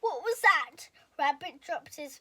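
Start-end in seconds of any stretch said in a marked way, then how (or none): 0.0-0.9 high, loud frightened gasp; startled. A narrator's reading. Rabbit startled by the knocking, loud and afraid. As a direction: jump at the sudden noise.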